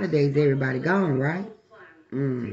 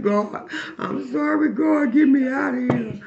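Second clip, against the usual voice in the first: high, slow pleading prayer; desperate prayer; 7 semitones higher than usual. Retold or imitated. Woman praying to escape, high slow pleading. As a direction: beg God, higher and slow. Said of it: "I was praying".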